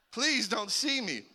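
high-pitched mock-desperate plea; retold pleading. Teen hiding behind garden gnome, comic pleading. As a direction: plead as if caught in a searchlight.

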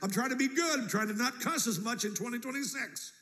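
high, pushy mock self-justification; audacious boasting. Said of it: "when I have the audacity".

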